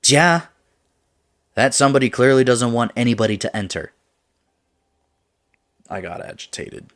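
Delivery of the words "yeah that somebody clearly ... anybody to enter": loud, high, fast comeback; quick, exasperated argument; heated retort. Acted. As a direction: fire it back, voice raised. Acted quarrel, retort.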